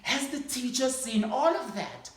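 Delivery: put-on eager little boy. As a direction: ask wide-eyed like a small boy. Boy asks teacher again.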